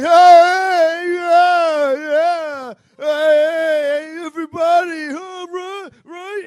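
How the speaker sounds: loud, high, brash shouting to the car; a swaggering entrance yelled at everybody; hammed-up loud showman greeting the whole subway car; cocky guy bursting in. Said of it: "he's like".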